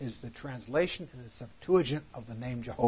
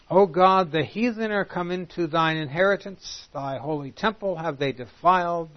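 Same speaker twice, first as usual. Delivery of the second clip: raised, solemn reading of the psalm; scripture reading; 3 semitones higher, 3 dB louder than usual. Acted. Preacher reads a psalm aloud to his class, solemn and raised. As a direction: read the lament out to the congregation.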